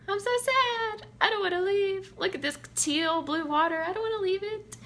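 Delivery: high, mock-sad whine; saddy pants. Vacationer moaning about leaving the teal water, mock sad. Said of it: "I get all, like, saddy pants".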